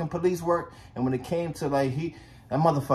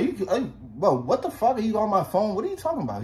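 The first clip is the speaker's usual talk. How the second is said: higher, stumbling incredulous questions; retold disbelief; 6 semitones higher than usual. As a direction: stammer out stunned questions, replaying the shock. Disbelieving reply to death news.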